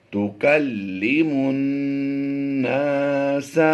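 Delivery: slow Quranic recitation, drawn out; recited slowly. Shown as a demonstration. Teacher reciting for students, slow and drawn out. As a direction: chant the phrase slowly like tajweed. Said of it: "Quran recitation demo".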